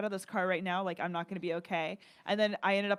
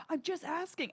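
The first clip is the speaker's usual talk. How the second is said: lightly imitated driver, pitched high; a hint of a whiny excuse; mock defensive driver; 9 semitones higher than usual. Driver's defensive reply, acted out.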